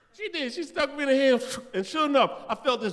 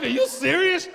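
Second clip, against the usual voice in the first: incredulous; 11 semitones higher, 5 dB louder than usual. Retold or imitated. Shocked at nurse's order.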